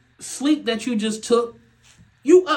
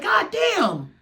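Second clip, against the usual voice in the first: exasperated shout; 9 semitones higher than usual. Retold or imitated. Dad venting about baby, a mock exasperated shout. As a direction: throw up your hands, god damn. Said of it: "I be like".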